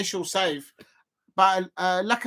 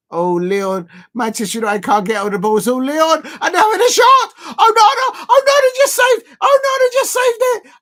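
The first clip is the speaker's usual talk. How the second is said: rising, louder and higher, building to a full yell; excited shout; 9 semitones higher, 9 dB louder than usual. Real, not acted. Fan watching his team nearly score, building excited shout. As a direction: call the chance as it builds until you're yelling. Live chance in the match.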